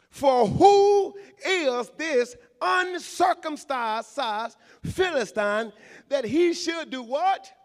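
loud, slow shouted scripture; preaching at full force. Acted. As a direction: thunder the verse from the pulpit.

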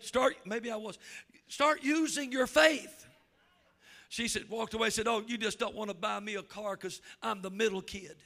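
loud, ringing pulpit delivery, slowed on the key lines; fiery revival preaching; full-force preaching. Acted. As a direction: preach it out to the whole room, building.

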